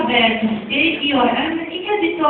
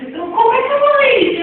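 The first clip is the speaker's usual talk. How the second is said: excited question; 10 semitones higher, much faster than usual. Real, not acted. Teacher asks how X is pronounced.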